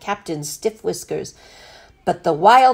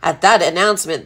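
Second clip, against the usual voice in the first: ordinary delivery, nothing marked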